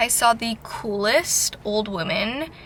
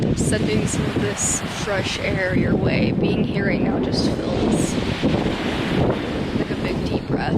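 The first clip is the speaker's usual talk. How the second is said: calm guided breathing. Real, not acted.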